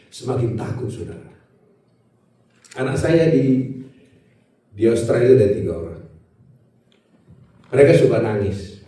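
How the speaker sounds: ordinary delivery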